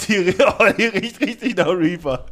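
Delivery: laughing. Real, not acted. Laughing while sniffing the candy.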